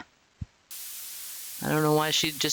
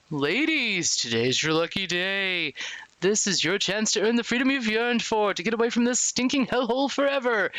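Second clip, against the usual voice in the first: warden's announcement; 5 semitones higher than usual. Acted. Warden offers prisoners a chance at freedom, grand announcement. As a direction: play the warden addressing all inmates.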